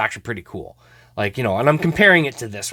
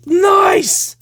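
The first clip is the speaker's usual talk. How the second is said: loud, very high-pitched cheer; real high whoop of delight; thrilled approval; 6 dB louder than usual. Real, not acted. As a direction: cheer it high over a great pull. Delighted at the trio card.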